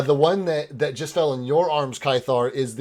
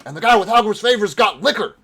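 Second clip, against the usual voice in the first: guard voice; 9 semitones higher, 9 dB louder, faster than usual. Acted.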